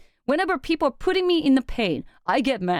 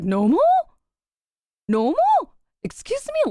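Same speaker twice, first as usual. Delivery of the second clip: incredulous echo; 9 semitones higher, 3 dB louder than usual. Retold or imitated. Shocked retort to therapist.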